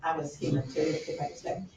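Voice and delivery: usual speaking voice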